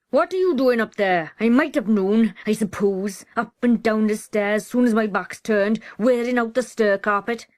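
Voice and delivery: loud, bullying scolding; a bullying brother scolding his sister loudly; loud hectoring. Acted. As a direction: berate her from the foot of the stairs. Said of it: "The loud, hectoring voice went on".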